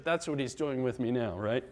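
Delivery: ordinary delivery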